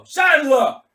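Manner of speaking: loud, high barked order; barking. Acted. Mad Mike ordering Lowe into the Humvee, loud bark. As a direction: snap the order at him. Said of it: "barking at Lowe".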